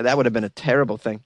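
usual speaking voice

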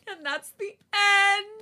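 high, slow triumphant announcement; excited. Real, not acted. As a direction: celebrate finishing the story. Finishing the story, excited.

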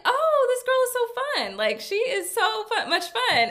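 high, drawn-out retold delight; swooping high-pitched enthusiasm; delighted gushing. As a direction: gush like a new fan. Retelling first delighted reaction to live.

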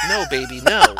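high squeaky mock pleading; pleading wife. Wife begs her angry husband, hammed-up high plea. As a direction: play the meek wife begging him in a falsetto. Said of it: "She's like".

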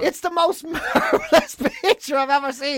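can't hold back the giggle at the photo; laughing delight. Real, not acted. Host admiring the hijack selfie, laughing with delight.

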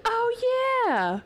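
very high "oh", dropping back down; sudden remembering. Retold or imitated. Daughter reminded of her forgotten chore, mock sudden remembering. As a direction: imitate a kid's belated realization.

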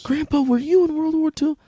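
put-on high, loud child's voice; little grandchild. Grandchild asking Grandpa about the war, high child voice. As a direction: pipe up high like an eager grandkid.

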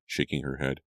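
plain reading voice

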